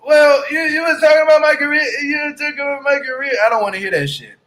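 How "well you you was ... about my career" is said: mocking Natalie. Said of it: "We don't wanna hear nothing about no".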